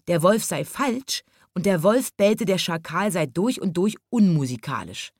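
usual speaking voice

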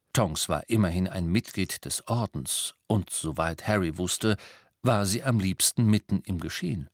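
ordinary delivery, nothing marked

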